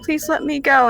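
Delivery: high, repeated frantic apologies; Joy. Acted. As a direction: plead like a frightened little girl.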